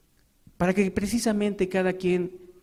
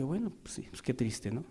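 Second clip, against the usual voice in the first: much softer, lower consoling reply; soft sympathy; 7 semitones lower, 11 dB quieter than usual. Retold or imitated. Preacher recalling consoling a weeping woman, hushed. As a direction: murmur it kindly.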